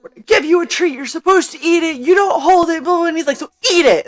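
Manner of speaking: scolding handler. Retold or imitated.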